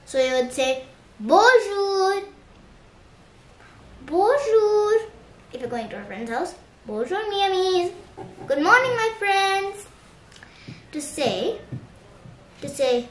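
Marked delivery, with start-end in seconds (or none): 1.2-4.9 high, drawn-out bright hello; sing-song greeting. Shown as a demonstration. Teacher greeting a relative in the morning, sing-song. 6.8-9.8 high, lilting call to friends; sing-song greeting. Shown as a demonstration. Greeting friends at their house, sing-song.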